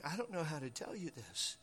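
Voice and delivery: very soft, mildly tearful acting; hushed, a touch of weeping; weeping, understated. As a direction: quietly act out reluctance through tears. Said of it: "and she's weeping".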